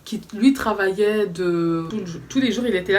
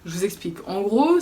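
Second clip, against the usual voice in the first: normal talking voice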